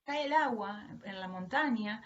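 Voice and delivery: usual speaking voice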